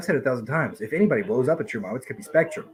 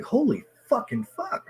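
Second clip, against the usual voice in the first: amazed; 12 semitones higher, slower than usual. Retold or imitated. Amazed by Ty's track.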